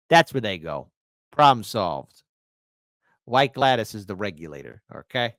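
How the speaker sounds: normal talking voice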